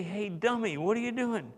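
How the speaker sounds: high-pitched mock scolding. Mock scolding God wouldn't say.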